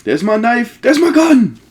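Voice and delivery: high, loud delighted cries; thrilled at finding gear; gleeful yelps of discovery; excited. Real, not acted.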